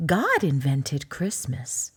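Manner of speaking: a patient mama answering her cub; unhurried mother bear voice; gentle mother bear. Acted.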